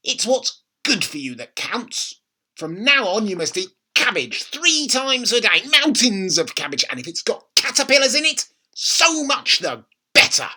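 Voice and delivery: grandma gloating about caterpillars; bossy grandma. A narrator's reading. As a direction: lay down the law, relishing it.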